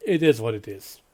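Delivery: ordinary talk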